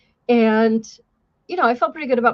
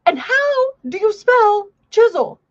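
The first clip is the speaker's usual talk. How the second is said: much higher, drill-instructor bark; yelling instructor; 11 semitones higher, 5 dB louder, slower than usual. Retold or imitated. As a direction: call the recruit out like a drill instructor. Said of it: "the instructors yell at Contreras".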